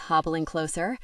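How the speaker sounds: plain reading voice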